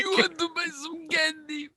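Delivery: high-pitched cartoon shopkeeper; candy shop guy's voice. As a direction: echo the impression way up high.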